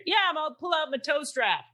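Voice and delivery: hearty local guy. Retold or imitated. Retold truck man offering help.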